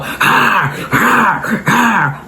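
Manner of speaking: retold dog barks; attack-mode dog bark. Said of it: "It was still in attack mode".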